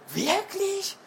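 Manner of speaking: high, surprised question. A narrator's reading. Mama hears of a birthday every Friday, surprised.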